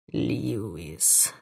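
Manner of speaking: low, muttered through teeth; through clenched teeth. A narrator's reading. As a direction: grind the name out through teeth. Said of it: "процедил старший". (сквозь зубы)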